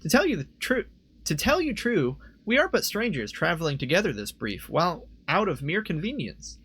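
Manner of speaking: high, singsong heroine voice; high-voiced adventurer, formal and lilting. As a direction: lift the pitch for an archaic reply. Rereads character's reply in voice.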